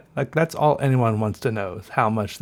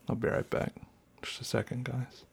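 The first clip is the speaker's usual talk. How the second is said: whispering; 9 dB quieter than usual. Real, not acted. Stepping away quietly at night.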